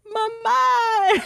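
very high, drawn-out mock child's call; little girl's cry. Little girl on the escalator to her mother, high imitated call. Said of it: "And then she's like".

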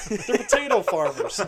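high loud laughing words. Real, not acted. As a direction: say it while cracking up. Laughing joke about Mars family.

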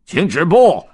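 high, loud command to stop; guard's shout. Acted. Palace guard stopping the duck, a loud shout. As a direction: bark the order at the gate. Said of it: "侍卫喊道".

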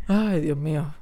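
normal talking voice

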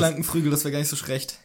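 "Krankenflügel" as "Klankenfrügel", "schlecht" as "schrecht"; mock tongue-tied, "schlecht" said "schrecht"; mimicked slips. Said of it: "der sich ständig verspricht".

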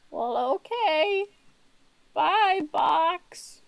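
put-on high, drawn-out old-lady assent; high old-lady goodbye; old woman Nancy. GM voices Nancy saying goodbye.

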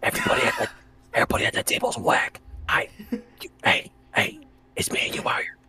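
forceful stage whisper; conspiratorial whisper. Acted. Dealer privately dismissing everyone at the table, forceful whisper. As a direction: whisper hard, as if dissing people within earshot. Secret pep talk as a dealer.

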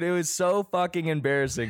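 normal talking voice

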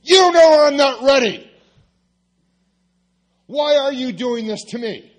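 high, loud anguished yell; screaming at God. Retold or imitated. Pastor in his car yelling at God, loud and high. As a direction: cry out to heaven at full voice. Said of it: "I start screaming at God".

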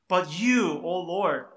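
"But you" lifted and punched, loud; emphatic "But you". Shown as a demonstration. Preacher reading the psalm, stressing "But you" loud. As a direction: lean hard on "But you, O Lord". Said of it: "is emphatic".

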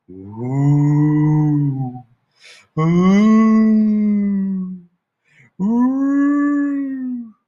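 rising, ghostly long ooh howl; eerie howl. Retold or imitated. Dog howling at the moon, heard as a ghostly wail. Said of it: "heard that noise again".

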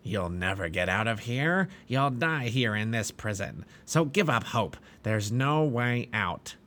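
slow, taunting giant voice; a jailer giant crushing their hope; cruel giant. Acted. Giant Despair taunting the pilgrims in the dungeon, slow cruel giant.

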